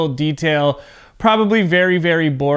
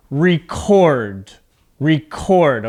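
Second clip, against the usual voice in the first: record with the weight on CORD; verb stress on second syllable; 2 semitones lower, much slower than usual. Shown as a demonstration. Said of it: "My voice goes up at the end".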